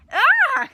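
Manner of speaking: loud, very high shriek of fright; startled scream. Acted. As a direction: scream in shock. Startled by legs behind tree.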